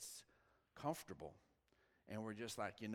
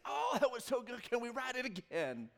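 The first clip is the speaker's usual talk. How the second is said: thrilled; 9 semitones higher, 4 dB louder than usual. Retold or imitated. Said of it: "the first thing she's like is".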